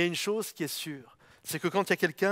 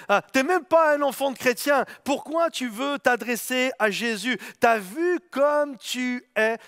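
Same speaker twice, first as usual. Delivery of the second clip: scornful crowd; 3 semitones higher than usual. Acted. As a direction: sneer at the beggar as a snobbish crowd. Scornful crowd mocking Bartimée.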